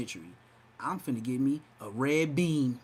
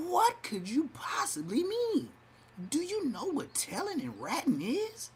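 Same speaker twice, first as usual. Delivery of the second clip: mock disbelief; 11 semitones higher than usual. Mocking a commenter's claim.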